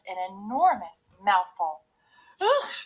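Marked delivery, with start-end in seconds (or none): 2.4-2.7 high, loud ugh of disgust; disgusted cry. A narrator's reading. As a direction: cry out in revulsion, pitched way up. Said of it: "she cried".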